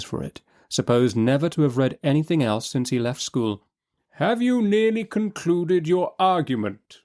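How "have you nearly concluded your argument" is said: high, slow judge's voice; weary judge. A narrator's reading. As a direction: press the lawyer politely. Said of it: "the reply".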